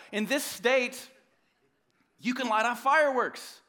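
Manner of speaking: hammed-up protest that Google said it was fine; mock indignant rant. Mocking their own excuse.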